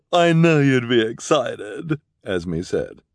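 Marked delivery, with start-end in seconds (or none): 0.1-2.0 high, loud, drawn-out squeal of delight; hammed-up thrill with a big gasp; gushing excitement. Esme presenting pinstripe suits, gushingly excited.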